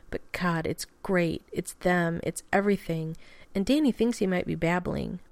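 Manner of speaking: ordinary delivery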